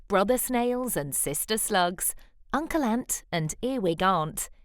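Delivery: ordinary delivery, nothing marked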